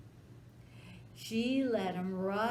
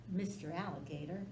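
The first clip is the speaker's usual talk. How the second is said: plain reading voice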